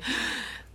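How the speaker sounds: jadeo sobresaltado imitado, sin voz; jadeo de susto. Retold or imitated. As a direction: imita a la madre que despierta con un jadeo sin voz. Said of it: "se endereza así como que". (la madre despierta de golpe y jadea, asustada)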